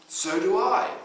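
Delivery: loud, high joking retort; a girl cheekily answering her counsellor; playful. Retold or imitated. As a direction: throw it back cheekily. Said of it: "joking reply, laughter follows".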